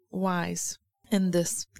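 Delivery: normal talking voice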